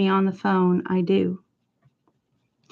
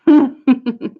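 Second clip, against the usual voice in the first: short put-on laugh; a quick, totally fake laugh; 4 dB louder than usual. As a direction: force out an obviously fake chuckle. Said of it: "That was totally fake, by the way".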